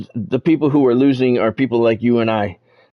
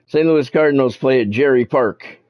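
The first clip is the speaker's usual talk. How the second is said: ordinary delivery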